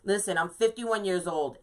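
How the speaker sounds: ordinary talk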